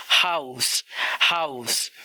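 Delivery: हाउस ending on a sharp, hissed s; the final s of हाउस stays unvoiced. Shown as a demonstration. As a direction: finish हाउस with a clear s. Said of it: "उसको एस की तरह pronounce किया".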